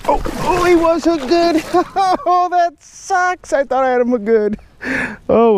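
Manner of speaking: high dismayed cry over lost fish. Real, not acted. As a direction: squeal in letdown.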